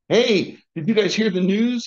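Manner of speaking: high, eager and loud; excited. Acted. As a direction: burst in with big news. Opie bursting in excited.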